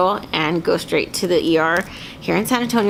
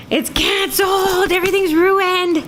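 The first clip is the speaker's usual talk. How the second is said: melodramatic despair; 11 semitones higher than usual. Retold or imitated. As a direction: throw a dramatic tantrum of despair. Melodramatic despair over video.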